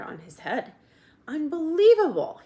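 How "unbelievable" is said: a loud, high outburst; loud and high, outraged; shouted. A narrator's reading. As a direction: Uncle exploding in disbelief. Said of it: "he shouted".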